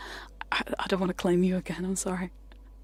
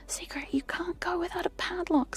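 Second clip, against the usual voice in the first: mock scolding nan, higher and whispered; a hint of a nagging nan; imitating nan scolding; 10 semitones higher than usual. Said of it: "my nan screamed at me".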